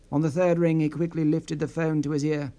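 ordinary delivery

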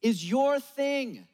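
is YOUR thing, slow with a falling end; stress on your. Acted. Preacher to the congregation, slow stress on YOUR. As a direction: lean on YOUR and let it drop. Repeated refrain, slowed.

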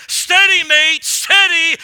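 ship captain calling. Retold or imitated. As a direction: call it out firmly over the waves like a captain. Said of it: "you hear the captain say".

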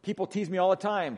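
ordinary talk, nothing marked

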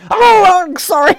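high, loud startled yelp of an apology; a jolted, squeaky mock apology. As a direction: jump as if caught out and blurt sorry. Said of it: "you're like".

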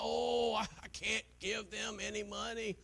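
a hint of a stingy churchgoer's whine; mock reluctant giver. Preacher mocking a stingy giver, lightly voiced. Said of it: "Not like".

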